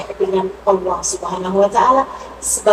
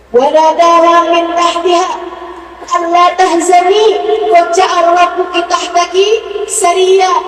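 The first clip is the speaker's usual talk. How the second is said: loud, raised Quran verse; fervent recitation; 6 semitones higher, 6 dB louder than usual. Acted. Preacher to the congregation, loudly reciting the verse. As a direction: proclaim the verse with full force.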